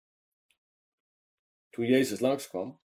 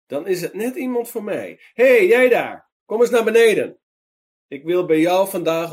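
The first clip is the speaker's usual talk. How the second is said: calling out loud and high to someone up a tree; hey you there, shout it up into the branches; calling up to him; 9 semitones higher, 11 dB louder than usual. A narrator's reading. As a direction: friendly call up into a tree. Jesus calls man in tree.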